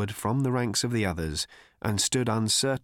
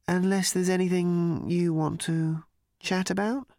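put-on higher, hesitant apologetic young man; uneasy cricketer; 8 semitones higher than usual. A narrator's reading. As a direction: shrug off the killing awkwardly. Cricketer offering to talk.

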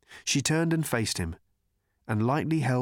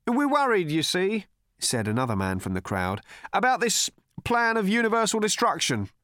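put-on higher, pleading young man; earnest worried cricketer; 9 semitones higher than usual. A narrator's reading. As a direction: explain your worry politely.

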